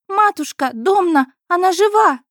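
high, loud alarmed squeal; shrieking. A narrator's reading. Daughter calling mother to the living patient, high shriek. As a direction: screech for mother in shock. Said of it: "заверещала дочь Ирина".

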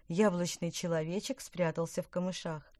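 ordinary delivery

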